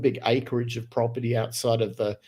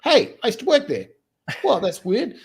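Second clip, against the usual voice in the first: much higher, startled "oh hey"; retold surprise; 10 semitones higher than usual. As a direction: replay the jolt of recognising the studio. Retold surprise at seeing studio.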